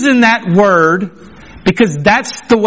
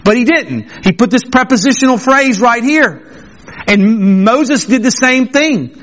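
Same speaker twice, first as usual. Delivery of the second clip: ordinary talk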